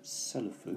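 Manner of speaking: "selufu" in three open syllables, se-lu-fu; katakana pronunciation. Shown as a demonstration. Teacher reads katakana from Japanese, "selufu" softly syllable by syllable. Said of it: "I can see in katakana".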